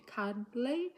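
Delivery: CUDDLY said as CUD, then LY; split into syllables. Shown as a demonstration. Teacher confirming two syllables, CUDDLY split into beats. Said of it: "two syllables".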